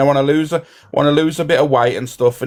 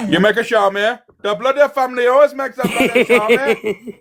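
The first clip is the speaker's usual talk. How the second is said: put-on high-pitched comic Easter East accent; 9 semitones higher than usual. Streamer showing off an impression to friends, Easter East accent. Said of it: "Wait until I do my Easter East".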